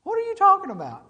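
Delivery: mock exasperated shout; exasperated yell. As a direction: blurt it out fed up. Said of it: "Finally, I was like".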